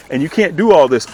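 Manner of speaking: ordinary delivery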